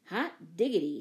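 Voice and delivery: put-on boy's cheer, not full volume; a hearty exclamation, lightly shouted; mild excited shout. A narrator's reading. Don cheering his sister's idea, in a mild shout. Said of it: "shouted Don".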